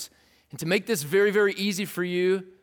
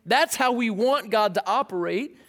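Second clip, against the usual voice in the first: ordinary talk, nothing marked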